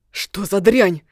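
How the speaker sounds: loud angry swearing; angry curse. Acted. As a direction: spit out the curse in fury. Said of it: "зло выругался за спиной эльф".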